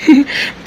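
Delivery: short amused laugh, high and bright. Real, not acted. Husband laughing at his wife's sulk, amused chuckle. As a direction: laugh her sulk off fondly.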